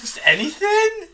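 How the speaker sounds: laughing in disbelief; incredulous laugh. Real, not acted. Co-host laughing at the crew shooting at everything. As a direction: squeak it out high through laughter, slow.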